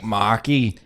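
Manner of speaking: "Marky" with an ee on the end; imitated Australian nickname "Marky"; added E ending. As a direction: tack an ee onto "Marky". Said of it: "add E to the end".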